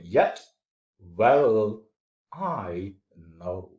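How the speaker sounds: "yet", "well", "I", "know" as separate beats with pauses; weak "yet", stronger "well", falling away on "I know"; iambic scansion. Shown as a demonstration. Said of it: "it is going like this".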